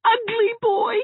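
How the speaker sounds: high, loud shrieking insult; shrill schoolyard taunt; childish yell. A narrator's reading. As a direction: shriek it at him like a furious little girl. Children's quarrel, yelled insult.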